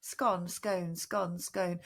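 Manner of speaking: "scone" as skon, then skone, twice over; alternating both pronunciations. Shown as a demonstration. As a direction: alternate "scone" rhyming with gone and with bone. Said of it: "I think I say both".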